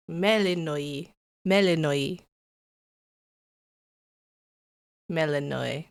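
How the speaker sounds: "Melanoi" said slowly, ending in "oy"; careful pronunciation. Shown as a demonstration. Streamer practising the name "Melanoi", careful pronunciation. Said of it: "we know how to say".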